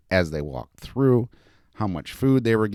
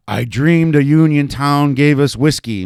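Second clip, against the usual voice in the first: put-on loud, raised growl in a German accent; 8 semitones higher, 9 dB louder than usual. A narrator's reading. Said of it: "growled at the little girl with his very thick German accent".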